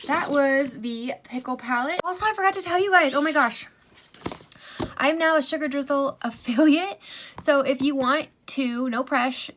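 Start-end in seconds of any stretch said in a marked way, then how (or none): 2.0-7.0 fast, loud and high with a laugh; excited. Real, not acted. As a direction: burst out with the news. Excited affiliate announcement.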